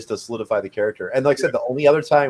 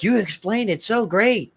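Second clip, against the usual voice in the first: mock gushing praise; 10 semitones higher, slower than usual. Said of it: "everybody in the group was like".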